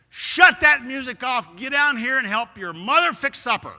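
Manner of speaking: stern father shouting at his daughter; angry dad order. Retold or imitated. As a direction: lay down the law over the music.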